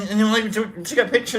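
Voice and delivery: ordinary talk, nothing marked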